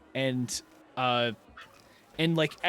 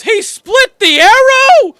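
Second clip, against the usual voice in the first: high, loud, slow cry of disbelief; astonished shout; 19 dB louder, slower than usual. Acted. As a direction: gasp it out as if you can't believe your eyes. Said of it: "someone goes".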